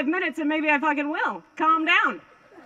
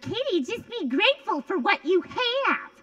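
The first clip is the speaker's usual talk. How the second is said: slow, higher, sing-song preachy voice; drawn-out mock advice from a know-it-all; preachy stranger; 8 semitones higher than usual. Stranger lecturing Katie about gratitude, mock preachy. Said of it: "people come up to you in the street and just be like".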